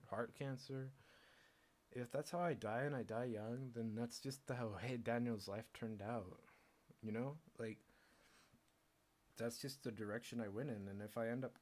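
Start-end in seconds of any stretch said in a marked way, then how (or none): none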